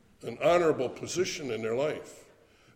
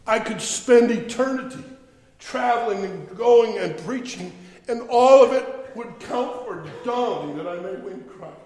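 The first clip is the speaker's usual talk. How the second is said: loud building preacher cadence, hammering each "all"; rising sermon climax, loud and insistent; preaching at full force; 6 semitones higher, 7 dB louder than usual. Acted. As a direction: preach it, driving the list home.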